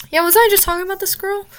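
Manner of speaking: high, quick surprise; surprised. Real, not acted. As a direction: suddenly realize you already mentioned her. Surprised realization mid-ramble.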